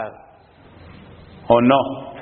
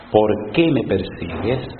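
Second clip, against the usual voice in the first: low, slow, solemn heavenly voice; a hushed divine rebuke; voice of Jesus; 11 semitones lower, slower than usual. Acted. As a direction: let it fall slow and grave from above. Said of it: "oyó una voz que le decía".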